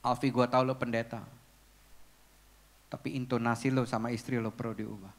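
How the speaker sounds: low, softened voice of a counselling friend; quiet frank advice. Retold or imitated. Friend correcting a pastor about his tone with his wife, quiet and low.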